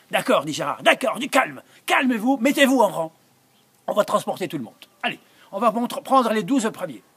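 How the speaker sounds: loud, high call over a crowd; calling for calm. Acted. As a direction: shout to get the kids in line. Gérard calming an unruly crowd.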